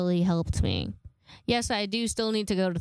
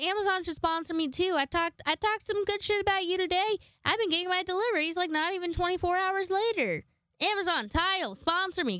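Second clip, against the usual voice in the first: much higher, pleading pitch to Amazon; pleading sponsor plea; 9 semitones higher than usual. Pretend. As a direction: beg Amazon to sponsor you in a high squeaky voice.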